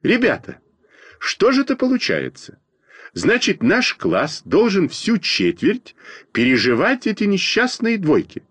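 high-pitched flustered protest; alarmed. A narrator's reading. Kuzyakina frets to classmates over the bad grades, alarmed. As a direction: cry out in alarm, pitch leaping up. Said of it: "всполошилась Кузякина".

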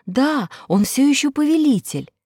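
plain reading voice

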